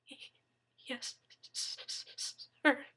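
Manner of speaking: stuttering, trembling whisper; terrified whisper. Acted. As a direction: whisper it, stammering in fear. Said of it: "Daniel whispered".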